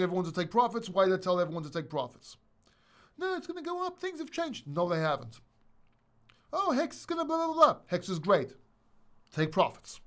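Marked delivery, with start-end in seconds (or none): 3.2-4.7 high-pitched, softer mock protest; mock objection, pitched way up; mocking naysayer. Mocking people who refused taking profits. 6.5-7.8 high-pitched mock fanboy chatter; mocking hype fan. Mocking Hex fans' hype.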